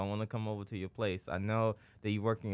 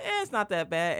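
dismissive shrug; 9 semitones higher, 4 dB louder than usual. Retold or imitated. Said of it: "and I was like".

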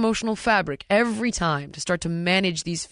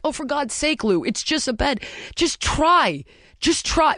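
high, exasperated retold scolding; impatient parent; 6 semitones higher than usual. Mother to crying daughter over a bed, retold impatient urging. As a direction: act out a parent losing patience. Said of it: "me being super shitty parent".

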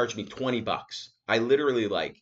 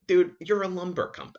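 louder, higher exasperated retort; indignant higher complaint; exasperated protest; 10 semitones higher, 5 dB louder than usual. Retold or imitated. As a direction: push back at the clerk, fed up. Arguing with rude clerk.

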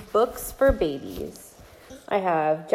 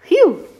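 relieved phew; 11 semitones higher, 11 dB louder than usual. Acted. Relief in story.

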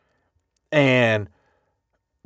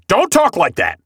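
higher-pitched, lightly acted reprimand; mock scolding; 5 semitones higher, 3 dB louder than usual. Dad rebuking family in the hospital, mock scolding. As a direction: act out a dad cutting off defeatist talk.

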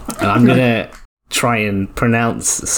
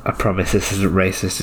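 normal talking voice

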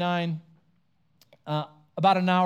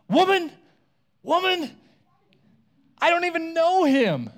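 high, loud panicked denial; 11 semitones higher, 5 dB louder than usual. Retold or imitated. Peter denying Jesus to the servant girl, high and loud. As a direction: shout the denial, voice pitched up. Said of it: "He denies it".

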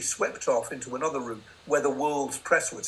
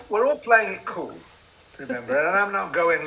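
ordinary talk, nothing marked

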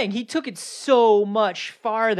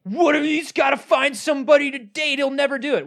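high, loud mocking whine; mock a small-minded naysayer; mocking whiny voice; 8 semitones higher, 5 dB louder than usual.

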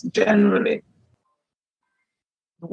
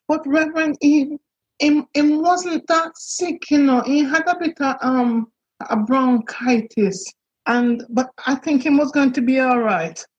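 imitated Jamaican woman; 5 semitones higher than usual. Woman on phone, Jamaican grammar.

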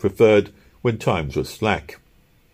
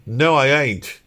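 mildly heated protest, raised at the end; heated denial; 6 semitones higher, 3 dB louder than usual. A narrator's reading. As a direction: deny it with some heat. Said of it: "the man cried heatedly".

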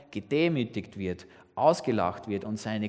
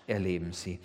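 ordinary talk

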